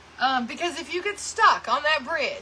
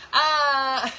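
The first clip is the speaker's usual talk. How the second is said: real laugh; 3 semitones higher than usual. Real, not acted. Still teasing Linda, laughing. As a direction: keep laughing.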